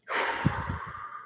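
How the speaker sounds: long soft breath out; slow deep exhale. Shown as a demonstration. Teacher modelling a deep breath for children, soft exhale. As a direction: blow the air out slowly. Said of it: "Take a deep breath".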